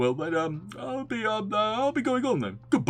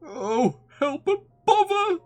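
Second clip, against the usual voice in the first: high, loud outburst; exasperated cry; 7 dB louder, slower than usual. A narrator's reading. Pooh is wedged in the doorway, crying out high and loud. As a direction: burst out high in dismay.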